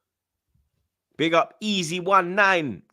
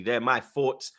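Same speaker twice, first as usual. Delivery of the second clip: normal talking voice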